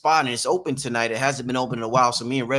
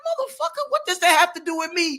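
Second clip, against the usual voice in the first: much higher, cracking outraged cry; mock outraged yell. Host retells his reply to a commenter, mock outrage. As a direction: yelp it in disbelief. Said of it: "I'm like".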